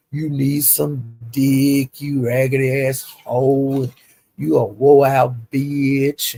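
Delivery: drawn-out, sneering retold insult; slow, sneering read of his insults; slow mocking quote of insults. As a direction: drag out each insult slowly, mocking him. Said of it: "he called me all kind of old".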